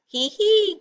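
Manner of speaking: very high robot giggle; giggled. A narrator's reading. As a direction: titter in a high little voice. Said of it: "giggled Robot".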